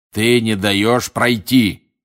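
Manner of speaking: slow, loud growled rebuke; loud, heavy accusation; angry confrontation. A narrator's reading. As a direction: push each word out forcefully. Moody confronts Karkaroff in the doorway.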